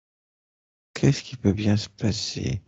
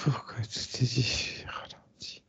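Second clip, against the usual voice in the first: whispering; 11 dB quieter than usual. Real, not acted. Repeats question to herself.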